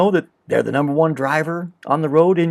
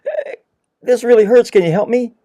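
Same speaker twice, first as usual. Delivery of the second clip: higher, louder whiny cry of pain; mock pained plea; 9 semitones higher, 8 dB louder than usual. Person pinching himself begs a friend, whiny and louder. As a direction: overplay the hurt to beg for help. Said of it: "saying to you".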